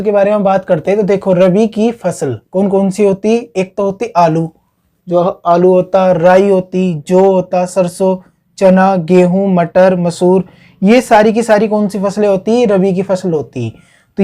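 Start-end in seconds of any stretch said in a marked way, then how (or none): none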